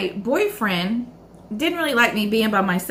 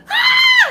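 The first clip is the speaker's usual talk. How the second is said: loud high mock scream; loud terrified scream; retold scream; 7 dB louder than usual. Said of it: "And I'm just screaming".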